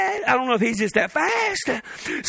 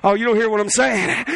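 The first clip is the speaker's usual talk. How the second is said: ordinary talk, nothing marked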